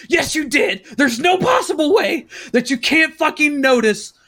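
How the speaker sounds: high, incredulous mock rant; hammed-up yelling, close to laughter; dad's angry scolding. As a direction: imitate an outraged father, slowing for the last blow. Acted argument, dad scolding.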